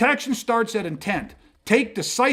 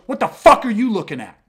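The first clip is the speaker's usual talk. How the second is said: loud, hostile "What the fuck are you looking at?"; aggressive challenge; 7 dB louder than usual. Retold or imitated. Big guy outside the store confronting the client, loud hostile challenge. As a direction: snarl it at a stranger by the door, much louder. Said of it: "stares at him and goes".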